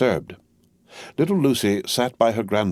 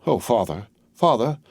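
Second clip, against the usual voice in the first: rising frightened plea; panicked plea; 7 semitones higher than usual. A narrator's reading. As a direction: beg father in terror. Lucy in panic.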